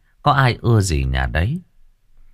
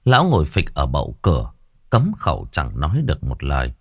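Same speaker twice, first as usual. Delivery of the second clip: ordinary delivery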